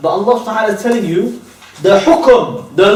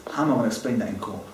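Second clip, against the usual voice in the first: quick and much softer, a lightly voiced fretting lawyer; mock worried solicitor; 11 semitones lower, 13 dB quieter, much faster than usual. Preacher mimics a solicitor fretting about court, quick, soft mock worry. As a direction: rush it under your breath like a nervous lawyer.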